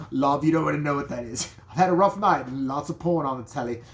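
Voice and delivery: lightly voiced British bloke; hint of a British man explaining. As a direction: shrug it off in a Cockney manner. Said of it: "not dropping the British accent".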